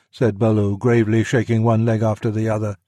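plain reading voice

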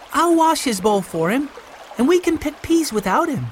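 put-on high girlish voice; high-pitched, willing girl; young girl Violet, obliging. A narrator's reading. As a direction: offer help like a young girl. Violet offering help.

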